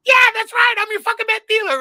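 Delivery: high, loud crowing yell; rowdy shout back. Retold or imitated. Fan shouting back at the host's meth joke, high crowing yell. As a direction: yell back proudly from the crowd.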